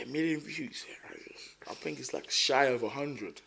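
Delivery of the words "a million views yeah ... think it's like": whisper. Real, not acted. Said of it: "drops to a whisper".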